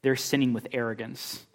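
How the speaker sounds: ordinary talk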